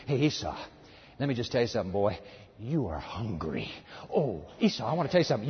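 low, soft tempting murmur; mock whisper. Satan whispering to hungry Esau, low and soft. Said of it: "Satan whispers to him".

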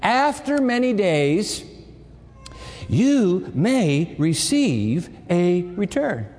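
drawn-out verse, each phrase weighted; slow scripture reading. Shown as a demonstration. Preacher rereading the verse slowly to the students. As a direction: savor every word of the verse. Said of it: "let's read that line again".

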